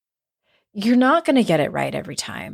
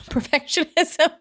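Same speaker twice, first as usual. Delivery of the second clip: laughing; 8 semitones higher than usual. Real, not acted. Laughs at own remark.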